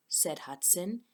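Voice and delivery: ordinary delivery, nothing marked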